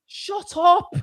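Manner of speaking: high, loud shut-up at the screen; annoyed shout. Real, not acted. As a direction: cut the commentator off, fed up. Telling commentator off again.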